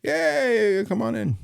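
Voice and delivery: high chirpy imitation of the desk; high imitated receptionist. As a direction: mimic the too-breezy voice on the phone. Imitating the voice on phone.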